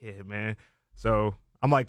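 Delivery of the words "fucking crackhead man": inner thought. Retold or imitated. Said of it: "in my head, I'm thinking".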